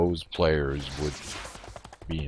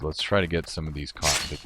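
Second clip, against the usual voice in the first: ordinary delivery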